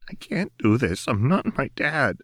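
holding back a sob; Jinx tearful. A narrator's reading. Jinx confessing to Lux she isn't her dad, tearful. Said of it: "Tears were falling".

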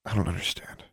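bewildered murmur. A narrator's reading. Bewildered, quieter repeat.

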